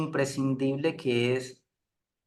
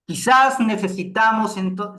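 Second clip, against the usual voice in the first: demonstrating a louder, higher, emphatic delivery; punchy, projected and raised in pitch; forceful voice modulation; 8 semitones higher, 7 dB louder than usual. Shown as a demonstration. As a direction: raise the voice to grab attention. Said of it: "esa contundencia llama la atención".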